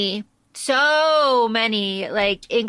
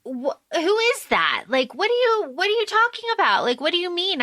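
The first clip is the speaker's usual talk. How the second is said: higher, puzzled questioning; confused Mina; 6 semitones higher, faster than usual. Retold or imitated. Mina confused when Jonathan sees the Count, higher puzzled voice. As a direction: ask it up high, lost and baffled. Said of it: "she's like".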